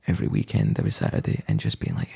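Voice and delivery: whispered, voiceless. Real, not acted. Telling the co-host about weekends in a whisper. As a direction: whisper it under breath.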